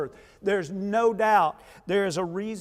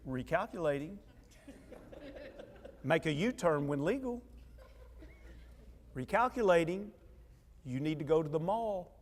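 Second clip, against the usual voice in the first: GPS voice; 2 semitones lower, 11 dB quieter than usual. Pretend. Imagined GPS guiding husband.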